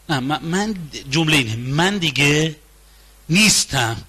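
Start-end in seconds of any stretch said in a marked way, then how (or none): none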